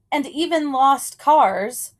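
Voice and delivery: ordinary delivery, nothing marked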